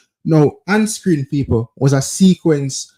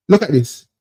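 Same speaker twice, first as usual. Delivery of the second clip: ordinary talk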